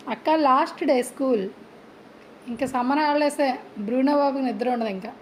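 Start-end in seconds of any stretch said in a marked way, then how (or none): none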